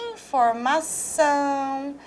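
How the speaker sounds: informação drawn out slowly, stress on the final ção; slow stressed demo word. Shown as a demonstration. Teacher showing students the stressed syllable, slow and deliberate. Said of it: "Olha só".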